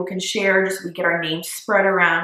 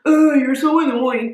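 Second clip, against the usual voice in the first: little brother; 5 semitones higher, 4 dB louder than usual. Retold or imitated. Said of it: "He's gonna watch this and be like".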